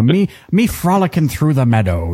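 frolicking through the meadow in a lilting mock Irish brogue; put-on Irish accent. Host joking how he'll talk in Australia, put-on Irish accent. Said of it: "really put it on".

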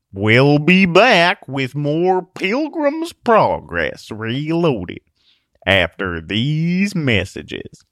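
slow, booming break announcement; radio announcer. Acted.

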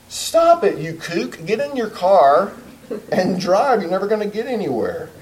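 high-pitched mock berating; exasperated scolding. Counselor berating a client afraid to drive, exasperated. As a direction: scold the client as if it's obvious.

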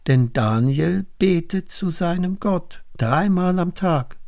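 high-pitched accusation; calling out. A narrator's reading. Princes accuse Daniel before the king, calling out. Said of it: "riefen die Fürsten".

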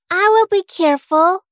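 put-on high, sweet little girl; bright young girl promising.

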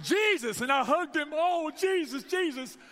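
high, loud, overjoyed cries of Jesus; joyful exclaiming. Retold or imitated. Pastor retelling hugging the stranger, joyful loud cries. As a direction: shout Jesus with happy relief, hugging him.

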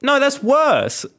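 high, urgent protest; panicked. Real, not acted. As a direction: cry out in alarm. Panicked after hanging up.

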